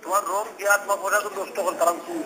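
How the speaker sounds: ordinary delivery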